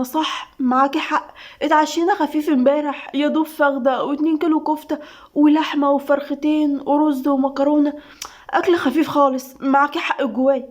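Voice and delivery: ordinary delivery, nothing marked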